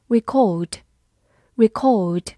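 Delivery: British "record", stressed on second syllable; British accent. Shown as a demonstration. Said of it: "英式发音读作record".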